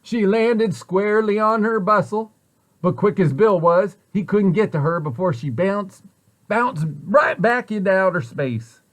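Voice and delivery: plain reading voice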